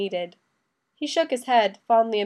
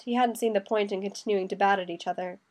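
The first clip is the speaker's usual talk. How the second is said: ordinary delivery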